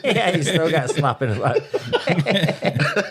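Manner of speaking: laughing through the words; chuckling while talking. Real, not acted. Laughing at wise guy joke.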